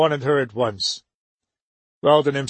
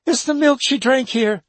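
horrified cry; 11 semitones higher than usual. A narrator's reading. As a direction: blurt the horror out. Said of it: "she cried suddenly".

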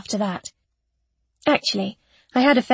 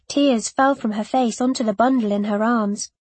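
usual speaking voice

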